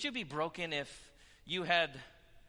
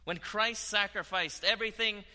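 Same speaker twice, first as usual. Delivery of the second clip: louder, higher preaching push; 7 semitones higher, 6 dB louder than usual. Acted. Preacher rebuking the congregation for holding back, preaching hard. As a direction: drive the rebuke home from the pulpit. Preaching rises to full force.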